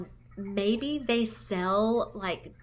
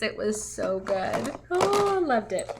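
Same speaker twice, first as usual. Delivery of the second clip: excited gush; 4 dB louder than usual. Real, not acted. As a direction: let the joy spill over. Gushing about best day.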